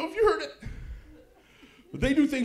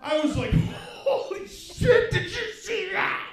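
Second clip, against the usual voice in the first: high, laughing, amazed exclaim; stretched laughing holy shit; gleeful disbelief; 13 semitones higher, 5 dB louder, slower than usual. Retold or imitated. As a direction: crack up at the stunt in awe. Kid dives onto brother.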